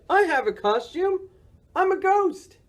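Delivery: high-pitched, delighted announcement; excited cry. A narrator's reading. Boris showing off his clothesline costume, excited cry. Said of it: "Boris cried".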